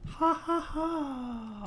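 drawn-out mock villain laugh; slow spooky laugh. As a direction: stretch out a creepy ha ha ha.

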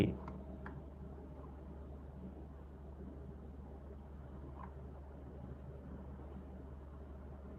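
slow, breathy whispered aside. Shown as a demonstration. Whispering to viewers to take good care of followers. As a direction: drop to a sly whisper on ดูแลเขาดีๆ. Repeats advice, then whispers it.

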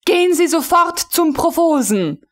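angry order. A narrator's reading. Frau von Melin ordering the officer to the provost, furious. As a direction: send him off sharply.

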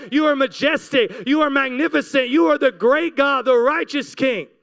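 normal talking voice

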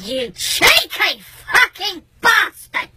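high, very drawn-out swearing; outraged insult. Retold or imitated. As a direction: stretch each word of the insult in disbelief.

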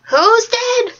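put-on deaf old woman. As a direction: shout it, confused and half deaf.

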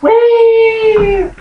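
very high, loud playful squeal; gleeful squeal. Acted. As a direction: squeal with glee. Grabbing a random book.

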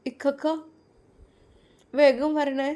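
put-on a young sister begging him to hurry back; little sister. Little sister begging her brother to return soon, high and pleading.

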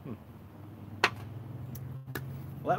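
ordinary talk, nothing marked

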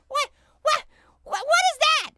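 high, loud indignant what; outraged disbelief. Real, not acted. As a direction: cry out in disbelief at the game. Outraged at paywall.

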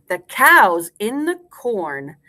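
slow, full-voiced nursery rhyme line; slow rhyme recitation. Shown as a demonstration. Teacher gives class a line to repeat, slow and clear. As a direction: space it out for repeating.

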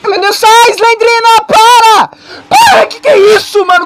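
high frantic shouting; yelling in real panic; being chased. Real, not acted. Chased by Slendrina.

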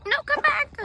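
high coaxing call. Real, not acted. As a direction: coax the cat back. Calling after a cat.

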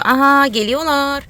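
loud high slow announcing; thrilled they're arriving; excited. Real, not acted. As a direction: owner sees them coming, excited and high.

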